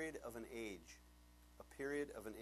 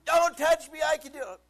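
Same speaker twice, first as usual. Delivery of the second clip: high, loud mock tantrum; defiant child's yell; 14 semitones higher, 17 dB louder than usual. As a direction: yell it like a stubborn little kid. Imitates daughter refusing help.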